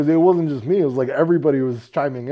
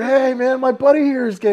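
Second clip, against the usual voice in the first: mock friend voice; 11 semitones higher, 5 dB louder than usual. Friends voiced in retold scene.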